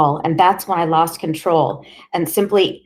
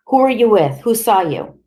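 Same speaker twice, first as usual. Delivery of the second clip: harsh interrogation; 5 semitones higher, 5 dB louder than usual. Acted.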